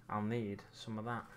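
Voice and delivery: ordinary talk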